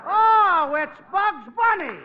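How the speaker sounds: thrilled to hear the caller; delighted shout of recognition; excited. Acted.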